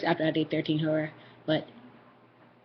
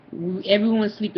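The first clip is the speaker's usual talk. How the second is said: plain reading voice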